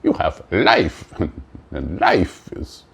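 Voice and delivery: put-on silly made-up European accent mixing niet and nein; vague fake foreign accent. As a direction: ham up an unplaceable foreign accent as Life itself. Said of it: "Why am I doing a fake accent that I can't even identify".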